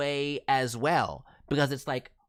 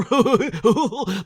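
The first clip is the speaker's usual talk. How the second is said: laughing; 6 semitones higher, 10 dB louder than usual. Real, not acted. Laughs after the demo.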